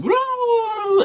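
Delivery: high excited whoop; imitating the audience's whoop; crowd cheer. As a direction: cheer like the whole theatre losing it. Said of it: "Everyone, like".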